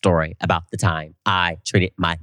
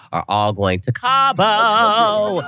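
slow build to a high, drawn-out CABO; re-enacted big surprise announcement; excited reveal; 14 semitones higher, 4 dB louder, much slower than usual. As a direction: announce it like a big prize, stretching the last word. Announcing the surprise gift trip.

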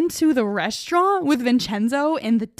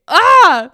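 loud high yelp of shock; shocked yell; 7 semitones higher, 12 dB louder than usual. Real, not acted. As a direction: burst out in a shriek. Shocked at twist, yelling.

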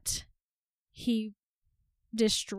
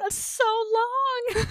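mock wail; 11 semitones higher, 5 dB louder, much slower than usual. Real, not acted. Overwhelmed by a sad book moment.

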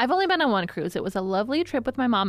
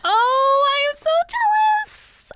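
excited squeal; 6 dB louder, slower than usual. Real, not acted. Excited squeal over Scotland.

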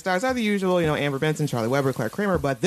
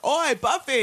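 mock yell; 9 semitones higher than usual. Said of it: "like, yelling at her".

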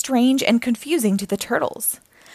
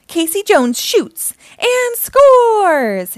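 high, loud, drawn-out goal call; sports announcer cheer; 10 semitones higher, 6 dB louder, much slower than usual. A narrator's reading. Casey flicks the puck, commentator's victory call. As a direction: stretch the final word triumphantly.

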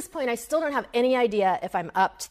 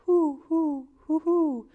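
higher hooting owl call; owl hoot imitation; 9 semitones higher, 4 dB louder, slower than usual. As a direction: hoot like a spooky horror movie owl. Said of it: "the horror movie owl".

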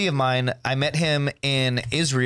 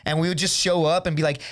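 ordinary talk, nothing marked

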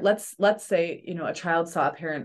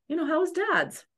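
light parent voice; 10 semitones higher than usual. Retold or imitated. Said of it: "you're like".

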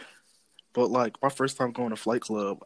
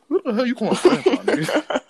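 high, louder, laughing indignant retort; indignant grandpa; 11 semitones higher, 4 dB louder than usual. Retold or imitated. Retelling how grandfather snapped at the clerk, laughing indignant. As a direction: laugh through a grandpa's offended comeback. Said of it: "He was like".

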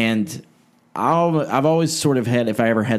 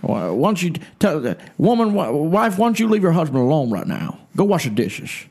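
high-pitched, put-on bossy preacher; hammed-up parody of a scolding preacher; Ruckman; 6 semitones higher than usual. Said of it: "here's my Ruckman impersonation".

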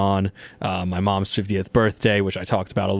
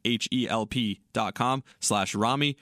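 softer, brisk sponsor read; steady, softer podcast ad read; 8 dB quieter than usual. Acted. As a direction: read the ad like a smooth host spot. Host reads the sponsor ad.

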